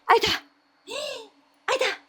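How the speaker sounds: imitated baby, fading away; high baby voice. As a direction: squeak it out like a one-year-old pointing at empty air. Baby pointing at nothing.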